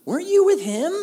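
put-on high, loud accusing girl's voice; a little girl pointing him out, shrill with suspicion. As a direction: servant girl calling out Peter, high and loud. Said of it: "She's like".

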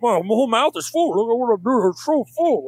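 lightly voiced girlfriend, talking as if her mouth is full. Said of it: "She's like".

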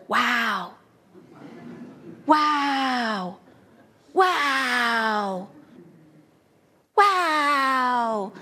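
lightly imitated delighted child saying "Wow" over and over; little girl's wow. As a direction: marvel at everything like a small girl. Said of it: "she knew one word".

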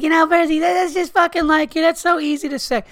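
high, loud whiny Bill voice; shrill mock whine of a friend arguing; mocking Burr. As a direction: squeal it like a drunk buddy defending his car. Drunk car argument, Burr voiced.

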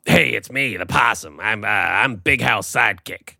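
gravelly little muppet imitation; put-on muppet-style possum voice; possum impression. Shown as a demonstration. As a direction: mimic a gravelly puppet possum. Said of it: "Do your possum voice for everybody".

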